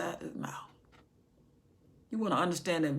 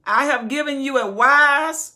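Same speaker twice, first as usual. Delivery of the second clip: loud, ringing voice of God; thunderous pulpit declaration; booming proclamation; 7 semitones higher, 13 dB louder than usual. Acted. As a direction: announce it with full power. Preaching at full force.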